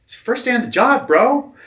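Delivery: loud, high-pitched disbelief; incredulous boss. Retold or imitated. Boss hearing his new coach quit on day one, incredulous. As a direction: imitate a boss yelping in astonishment. Boss acted reacting to sudden quitting.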